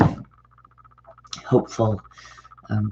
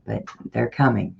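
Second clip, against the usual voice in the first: usual speaking voice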